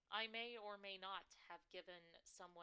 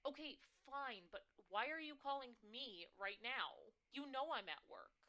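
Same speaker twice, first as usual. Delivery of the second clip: exasperated daughter; 5 semitones higher, 7 dB louder than usual. Acted. Daughter arguing on phone.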